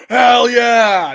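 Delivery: loud, high hype yell; hyped-up "hell yeah" cheer; celebratory shout. Acted. Acted celebratory cheer, pondering a replacement.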